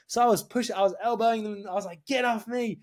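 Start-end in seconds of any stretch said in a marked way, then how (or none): 2.0-2.8 high-pitched re-enacted yelling, building louder; retold screaming. Boyfriend elbowing security guards away, retold scream. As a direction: act out shouting while resisting.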